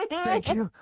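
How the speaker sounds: high, squeaky panicked thanks; high mock flustered phone voice; flustered call agent. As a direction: rush the thanks like caught out. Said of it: "answering emergency call flustered".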